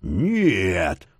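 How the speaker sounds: rasped hoarse cry. A narrator's reading. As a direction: rasp it out, voice changed. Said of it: "прохрипел он изменившимся голосом".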